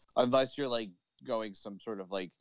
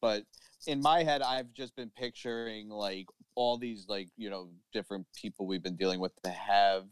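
ordinary delivery

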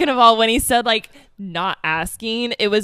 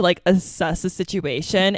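normal talking voice

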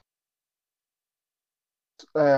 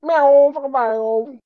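mock meowing; 9 semitones higher, much slower than usual. Echoing the meow impression.

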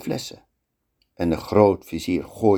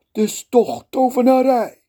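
put-on much higher, old sultan's grumble; 14 semitones higher than usual. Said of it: "mompelt hij".

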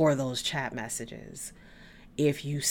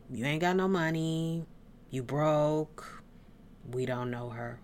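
usual speaking voice

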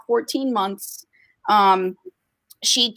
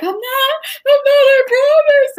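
much higher, slow, pleading; pleading promise; 7 dB louder, slower than usual. Retold or imitated. Woman promising her fiance, high squeaky plea. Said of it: "I was like".

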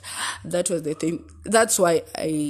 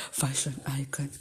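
soft whispered repeat; whispered aside; 3 semitones lower, 7 dB quieter than usual. Real, not acted. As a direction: slip the words in under your breath.

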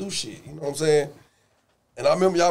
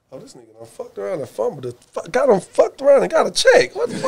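amazed disbelief; 6 semitones higher, 5 dB louder than usual. Retold or imitated. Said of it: "I was like".

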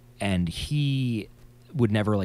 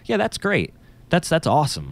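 sarcastic; 8 semitones higher than usual. Acted. Sarcastic praise of exposure.

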